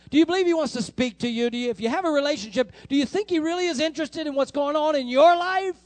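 high, loud challenge to the congregation; full-force preaching. Real, not acted. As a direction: press the crowd with urgent questions.

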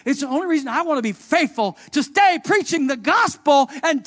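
loud, high preacher's build; full-force preaching. Acted. Pastor stirring the congregation, preaching at full force. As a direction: preach it with fire.

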